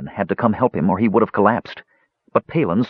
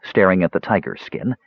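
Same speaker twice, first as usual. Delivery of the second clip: plain reading voice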